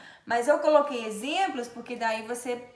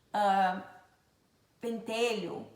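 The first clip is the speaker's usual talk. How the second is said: usual speaking voice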